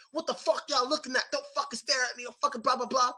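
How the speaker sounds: high mock-angry snapping at strangers; angry friend. Friend on the train yells at staring people, mock angry. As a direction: play the angry friend glaring at the train crowd. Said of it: "He was angry".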